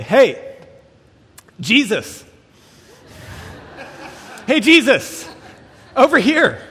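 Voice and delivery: high, loud mock shout with a laugh; woman calling to a bearded driver from the street; calling out across the street. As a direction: shout from the curb to get his attention.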